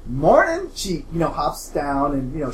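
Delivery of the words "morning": high, loud chirpy greeting; hammed-up cheery camper calling to Heather; perky camp girl. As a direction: bounce in like an overeager camper.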